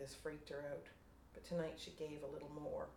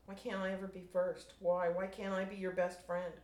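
louder pleading cries; hurt shouting at the sky; mild acted yell, rising to Mineo; 4 semitones higher, 7 dB louder than usual. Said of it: "she yelled".

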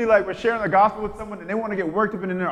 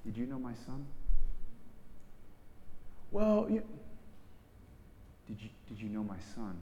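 very soft and low, grave; hushed solemn question; 6 semitones lower, 11 dB quieter than usual. Retold or imitated. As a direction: ask it softly and gravely. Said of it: "he'll ask the question".

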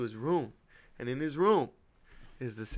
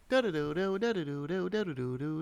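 sing-song phone ringtone imitation; mimicking a cell phone ringing; hummed ringtone; 3 semitones higher than usual. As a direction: hum the ringtone tune.

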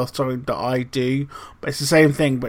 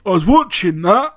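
mock indignant mum; 5 semitones higher, 4 dB louder than usual. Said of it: "and go".

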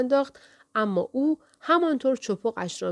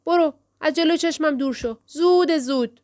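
angry dismissal; 7 semitones higher, 4 dB louder than usual. A narrator's reading.

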